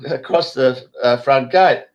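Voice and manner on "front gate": usual speaking voice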